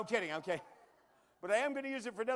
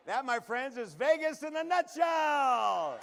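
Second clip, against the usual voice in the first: punchline shout; 5 semitones higher, 8 dB louder, much slower than usual. Real, not acted.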